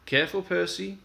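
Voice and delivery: a mock caution to a friend; cheeky warning. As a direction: warn him with a smirk. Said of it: "he warned".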